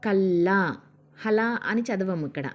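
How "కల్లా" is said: "కల్లా" with a long, held L; doubled L sound. Shown as a demonstration. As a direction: read "కల్లా" with la, holding the double L. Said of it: "లా అనే pronunciation వస్తుందన్నమాట".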